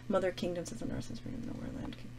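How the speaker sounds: near-whispered, soft scanning under the breath; muttering to self. Real, not acted. Teacher hunting the longest word in the poem, muttering. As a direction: read quietly to yourself, barely audible.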